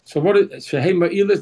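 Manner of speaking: normal talking voice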